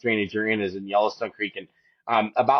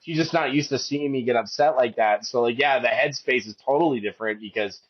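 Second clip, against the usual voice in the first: usual speaking voice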